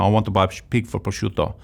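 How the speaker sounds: a hint of an Italian buyer at the butcher's; Italian accent. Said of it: "And I'll do the accent".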